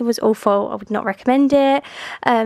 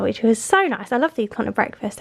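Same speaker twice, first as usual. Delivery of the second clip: normal talking voice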